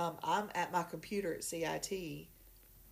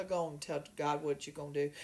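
ordinary talk, nothing marked

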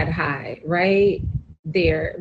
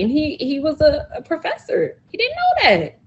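amazed; 9 semitones higher than usual. Real, not acted. Amazed the professor didn't know.

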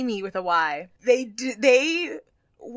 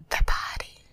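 whisper. Retold or imitated. Tyra Mail catchphrase.

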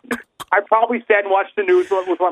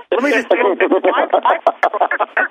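ordinary talk

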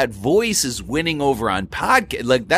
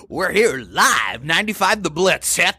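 radio DJ voice; 6 semitones higher than usual. Retold or imitated. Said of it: "I do miss that about".

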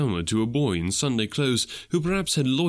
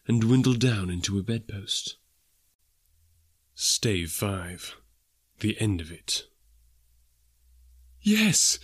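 usual speaking voice

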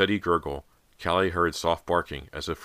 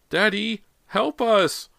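very high, loud shriek; panicked cry for help; 6 dB louder, much slower than usual. A narrator's reading. Girl calling her father to the flooded bathroom, panicked. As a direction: call out desperately, high and loud. Girls screaming for their father.

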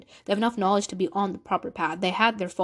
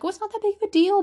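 high, loud mock dismissive shrug; dismissive brush-off; 11 semitones higher, 4 dB louder, faster than usual. Imagined viewer waving off her guilt, mock dismissive. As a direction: toss it off like it's nothing.

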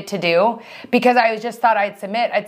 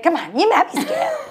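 put-on higher cooing baby voice, laughing; imitated baby talk; 8 semitones higher than usual. Retelling the friend cooing at dinner, laughing baby talk. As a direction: coo like a mother to a toddler. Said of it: "She does this, like, weird talk".

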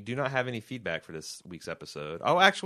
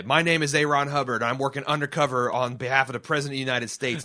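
louder, higher mock declaration to a cop; mock undercover claim; 5 semitones higher, 6 dB louder than usual. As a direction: proclaim it like a fake secret agent, hammed-up. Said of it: "I'm going to say".

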